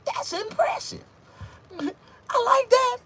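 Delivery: high, loud impressed woman's voice; mock flirty woman.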